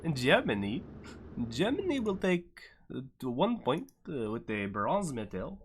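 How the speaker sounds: shaky attempt at a German accent with swinging pitch; bad German accent. Race host awarding Germany the bronze in a badly faked German accent. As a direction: fumble through a mock German accent. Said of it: "I don't know if that's German either".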